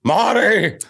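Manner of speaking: high excited shout of Marty; Doc Brown. Retold or imitated.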